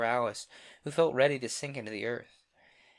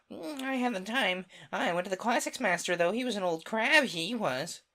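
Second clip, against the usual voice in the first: Gryphon voice; 9 semitones higher, 5 dB louder than usual. Acted.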